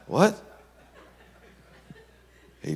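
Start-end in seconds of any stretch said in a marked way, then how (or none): 0.0-0.4 sudden high yelp of surprise; shocked what. Retold or imitated. Charlie startled that Tony knows about him. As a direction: jolt up in disbelief. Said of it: "Charlie's like".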